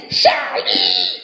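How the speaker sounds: low husky shouted call; calling Charlie. Retold or imitated. Cashier summoning the bouncer, husky shout. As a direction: call for backup across the restaurant. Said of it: "elle a fait".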